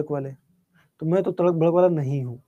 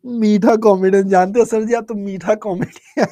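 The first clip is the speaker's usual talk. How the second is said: laughing while repeating the phrase, higher and louder; 4 semitones higher, 6 dB louder than usual. Real, not acted. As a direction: laughs out "मीठा comedian" in amusement. Teacher laughs at the "sweet comedian" comment. (हँसते हुए "मीठा comedian")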